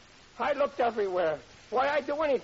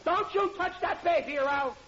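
angry shout; 10 semitones higher than usual. Acted. Riley threatens his father-in-law, high furious shout. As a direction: warn him off the baby, yelling. Quarrel over the baby.

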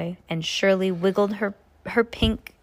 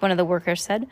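plain reading voice

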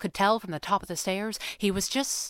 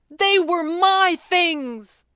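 loud, high, drawn-out protest; louder than intended; 12 semitones higher, 8 dB louder, much slower than usual. A narrator's reading. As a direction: blurt it out too loudly, stretching each word. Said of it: "probably louder than he meant to".

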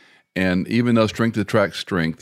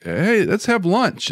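much higher, slow friendly offer; breezy friendly invite; 11 semitones higher, slower than usual. Retold or imitated. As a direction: sing it out like a casual invite.

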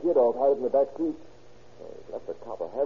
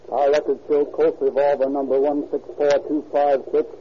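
louder, measured reading of a wire; telegram read aloud; 7 dB louder than usual. A narrator's reading. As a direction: read the telegram like an official dispatch. Narrator reads the telegram reply.

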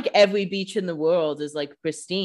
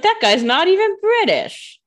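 high, loud, slow mocking scoff; Enzo's sneer; 11 semitones higher, 6 dB louder than usual. Enzo dismissing Stefan as a rival, slow high mocking scoff. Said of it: "he's like".

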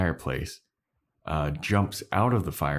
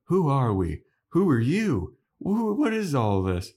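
high, bewildered questions; confused gang; 8 semitones higher than usual. Retold or imitated. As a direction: sound lost and baffled. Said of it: "They're all like".

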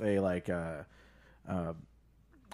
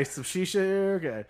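normal talking voice

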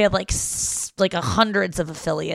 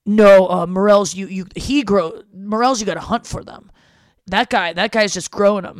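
louder insistent parent voice; parents insisting; 2 semitones higher, 8 dB louder than usual. Retold or imitated. Parents insisting morels must be hunted, loud mock insistence. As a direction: push back like a stubborn parent. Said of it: "they're like".

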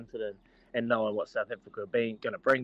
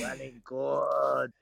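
slow race call; much slower than usual. Retold or imitated. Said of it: "race call retold".

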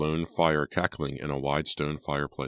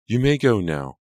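curt dismissal, higher and louder; 6 semitones higher, 6 dB louder than usual. A narrator's reading. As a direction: dismiss him sharply. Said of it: "Angelica replied curtly".

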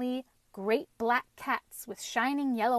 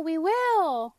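loud, higher shout, a little less than the first; called out in chorus, echoed; 7 semitones higher, 7 dB louder than usual. A narrator's reading. Vegetables repeating their promise, loud cheer. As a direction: cheer it back as the echo. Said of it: "called the vegetables in chorus".

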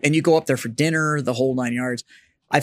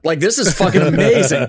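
laughing; 4 semitones higher, 6 dB louder than usual. Real, not acted. Laughing recalling the wild ride.

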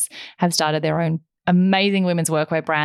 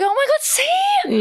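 a slow, much higher squeal of vindication; excited see; slower than usual. Retold or imitated. Retelling how she told everyone so, in a high squeal.

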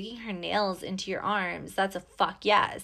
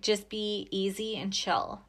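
ordinary delivery

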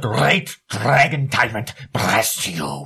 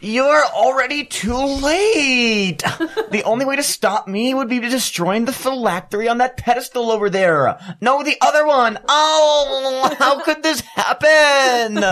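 high, theatrical lich villain; 10 semitones higher than usual. Acted. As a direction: ham up the cornered lich. Improv villain lich bit.

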